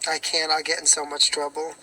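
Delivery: ordinary delivery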